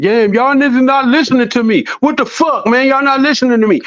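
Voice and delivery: exasperated mock outburst at the crew not listening; mock impatient outburst. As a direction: play your own short temper, exasperated. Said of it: "My impatience".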